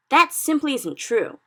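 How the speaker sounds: loud, slow, indignant retort; angry cry. A narrator's reading. Sophie angrily contradicting the BFG, loud and indignant. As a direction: snap back in protest. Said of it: "Sophie cried angrily".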